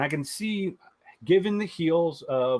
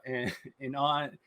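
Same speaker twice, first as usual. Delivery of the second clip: normal talking voice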